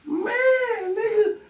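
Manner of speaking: high, cracking sobbing voice mixed with laughter; crying. Real, not acted. Breaking down over dead brother.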